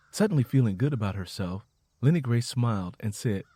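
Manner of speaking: usual speaking voice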